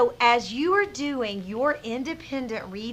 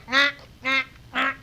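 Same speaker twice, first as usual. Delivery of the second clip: quick demonstration of bird snorts; snorty little bird noise; snorting bird; 2 semitones higher than usual. Shown as a demonstration. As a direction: snort the "knack" sounds. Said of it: "kind of snort way".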